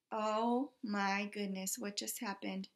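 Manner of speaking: ordinary delivery, nothing marked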